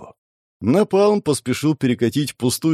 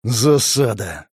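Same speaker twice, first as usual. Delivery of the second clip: slow, drawn-out swearword; muted curse; much slower than usual. A narrator's reading. Pyromancer learns the exit is blocked, mild cursing. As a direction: curse the bad luck, stretching the word. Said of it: "выругался пиромант".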